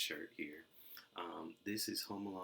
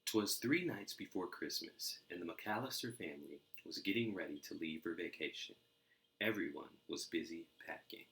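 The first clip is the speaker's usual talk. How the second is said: lively storybook reading; animated read-aloud for kids; story narration. A narrator's reading. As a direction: read it like a bedtime picture book. Reading picture book aloud.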